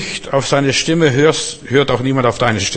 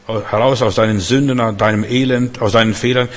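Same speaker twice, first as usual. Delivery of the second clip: usual speaking voice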